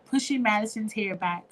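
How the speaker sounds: usual speaking voice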